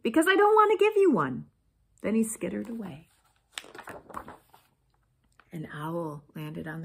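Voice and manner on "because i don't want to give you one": very high, loud comeback; sassy little lizard; snippy retort. A narrator's reading. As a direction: throw the snub back, high-pitched. Lizard snubs the cactus.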